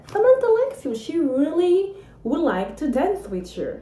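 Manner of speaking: high, sing-song teasing voice; mock the little crush in a high voice; teasing cousins. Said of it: "to go and see that boy and say".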